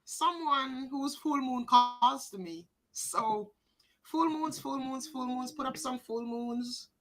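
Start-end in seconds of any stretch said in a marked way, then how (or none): none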